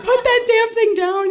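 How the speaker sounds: high, loud, drawn-out command; mock-exasperated husband shouting an order; imitated angry order. Husband yells at wife carrying the kitty, mock angry.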